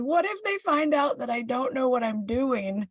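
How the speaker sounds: high, drawn-out mock wail; whiny, tearful confession, acted out; retold tearful wail. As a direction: slowly wail it as if crying to a husband. Crying, confesses to her husband.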